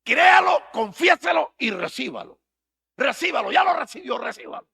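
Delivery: high, loud shouted slogan; mocking preacher slogan. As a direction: belt the catchphrase like a showman preacher. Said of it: "Eso tienen ellos". (eslogan gritado en burla de predicador)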